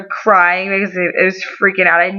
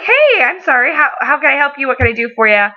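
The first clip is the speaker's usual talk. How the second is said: high, perky mock service greeting; customer-service voice; 6 semitones higher than usual. Bakery worker to walk-in customer, put-on customer-service voice. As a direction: jump up high, eager to help.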